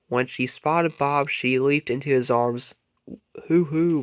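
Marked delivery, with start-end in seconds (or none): 3.5-4.0 laugh. A narrator's reading. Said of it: "Bob laughed".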